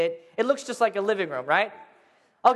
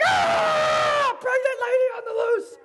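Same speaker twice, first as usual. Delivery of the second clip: panicked shriek; slower than usual. Retold or imitated. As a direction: shriek and run.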